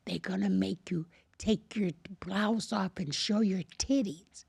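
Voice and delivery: low, secretive stage whisper. Retold or imitated. Janitor tipping off a new acting student, whispered. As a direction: imitate a man whispering a warning. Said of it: "he whispered at me".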